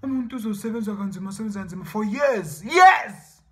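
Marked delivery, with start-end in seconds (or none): 2.6-3.4 loud, high outburst on one word; exasperated cry. Real, not acted. Lamenting years of hard work, a loud high cry. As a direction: cry it out in disbelief.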